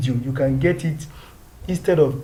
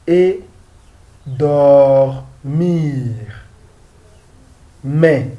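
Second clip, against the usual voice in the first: slow French, long pauses between words; deliberate, spaced-out French reading; word-by-word model reading; 6 dB louder, much slower than usual. Shown as a demonstration. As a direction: read it out for learners, one word at a time.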